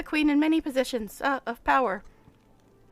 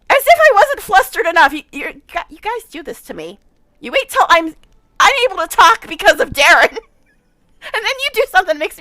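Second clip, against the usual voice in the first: loud, flustered protest; overwhelmed, half laughing; 3 semitones higher, 9 dB louder than usual. Real, not acted.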